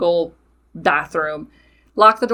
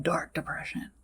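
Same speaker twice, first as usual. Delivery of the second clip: whispered; 2 semitones lower, 13 dB quieter than usual. Real, not acted. Describing her worst depression.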